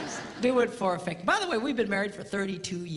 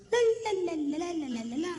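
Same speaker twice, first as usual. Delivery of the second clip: wife's fading la-la; 9 semitones higher, faster than usual. Retold or imitated. Wife moving away.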